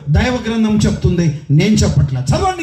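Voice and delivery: ordinary delivery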